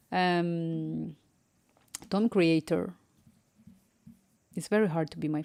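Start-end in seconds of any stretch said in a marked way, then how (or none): none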